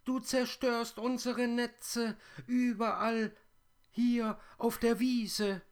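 piercingly high, loud spider chorus; loud plaintive squeal, far above natural pitch; high whirring voices. A narrator's reading. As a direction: cry out as tiny complaining creatures. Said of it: "in hohen Tönen sirrende Stimmen".

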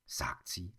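ordinary delivery

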